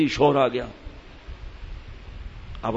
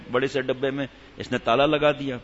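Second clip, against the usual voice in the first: ordinary delivery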